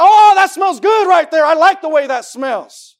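slow, loud, high shout of delight; God. Acted. Preacher voices God pleased by the offering, excited. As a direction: draw it out with booming excited relish. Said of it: "I think he gets excited".

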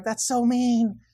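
mimicking the ladies scolding the guys; imitating the ladies. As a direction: chide like a shocked woman. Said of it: "the ladies were like".

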